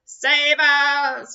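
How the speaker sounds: loud pleading call; pleading cry. Acted. Jailed pirates crying out to their captain, loud and pleading. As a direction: beg loudly for rescue. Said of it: "cried the pirates".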